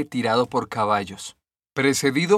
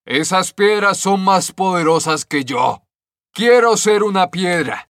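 higher, louder shouted demand; cloud shouting; 5 semitones higher, 5 dB louder than usual. A narrator's reading. The cloud shouting at the stones, loud and higher. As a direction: cry out jealously at the stones. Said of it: "la nube gritó".

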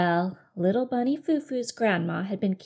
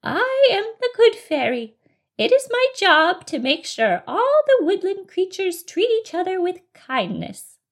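high, grand fairy proclamation; 11 semitones higher, 6 dB louder than usual. Acted. Good Fairy explains her job to bunny, high fairy voice. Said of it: "she said in a fairy-like voice".

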